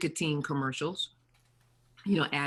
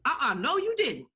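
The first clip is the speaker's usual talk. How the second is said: higher and louder, a sassy no-no; sassy mock scolding; 9 semitones higher, 6 dB louder than usual. To a friend, imitating the sassy version, higher and louder. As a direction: flip into a sassy scold, pitch jumping up.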